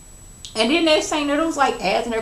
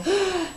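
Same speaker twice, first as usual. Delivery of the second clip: gasp; 10 semitones higher, 5 dB quieter than usual. Retold or imitated. Mock shocked reaction to Tammy.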